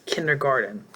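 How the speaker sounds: normal talking voice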